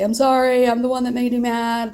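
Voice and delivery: high, loud mock grovel; wife imagining begging her husband's pardon; pleading apology. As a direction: beg forgiveness, hammed up.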